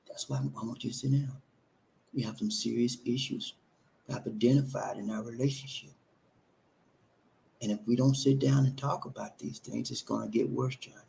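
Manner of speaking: quietly firm wife; calm, soft wife. Acted. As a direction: keep the wife soft and patient against his yelling. Role-play of the wife asking calmly.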